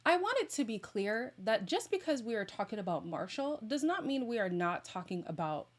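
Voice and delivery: ordinary talk, nothing marked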